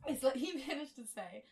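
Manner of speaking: narration breaking into laughter; laughing. Real, not acted. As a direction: laugh through the line. Laughs mid-line.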